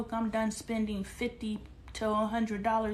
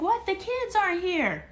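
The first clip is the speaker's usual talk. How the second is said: put-on much higher, whiny teen; teen daughter voice; 11 semitones higher, 5 dB louder than usual. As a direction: mimic a bratty teen. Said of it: "She's like".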